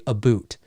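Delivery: "a boot" with an OO vowel. Shown as a demonstration. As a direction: turn "about" into "a boot". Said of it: "never heard anybody ... ever say".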